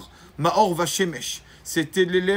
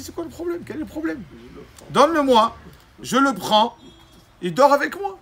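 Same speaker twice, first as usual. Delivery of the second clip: eager rabbi; 8 semitones higher, 5 dB louder than usual. Acted. Rabbi eagerly offering to take child.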